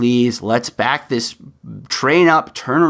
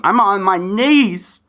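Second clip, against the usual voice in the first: higher, earnest plea on his knees; heartfelt begging plea; pleading; 7 semitones higher, slower than usual. Real, not acted. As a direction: beg the audience sincerely. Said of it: "I beg you".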